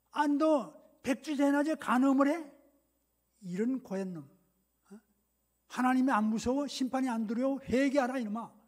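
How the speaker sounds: loud, indignant scolding; acted shouted rebuke. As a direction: bawl out the culprit and demand repentance. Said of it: "막 소리를 치고 호통을". (소리치며 꾸짖는 호통)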